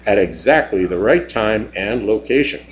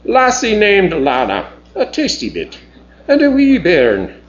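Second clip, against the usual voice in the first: Scottish brogue, higher and bright; Celtic dialect; 7 semitones higher than usual. Acted. Dell reporting the visitors to the captain in broad Scots. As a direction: lay on the Scots burr, pitched up. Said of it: "her Celtic dialect".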